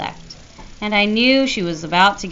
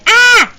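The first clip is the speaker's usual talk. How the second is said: high, loud mock scream "Ah!"; a child's startled yelp, acted out; retold scream; 14 dB louder than usual. Jamie showing how he screamed at the kid, mock yelp. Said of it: "I was like".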